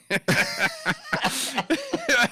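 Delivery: imitated helpless high laugh; laughing hard. Man behind in line hears the dumpster offer, laughing hard. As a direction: crack up wordlessly, pitched high. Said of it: "I was laughing my ass off".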